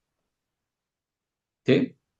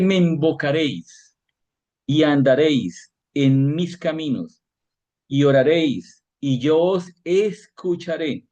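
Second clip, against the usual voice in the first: slow, raised, solemn reading of the verse; solemn scripture reading; 4 semitones higher than usual. Acted. Preacher to congregation, a slow solemn scripture reading. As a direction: proclaim each phrase slowly, like scripture. Reads Jeremiah aloud.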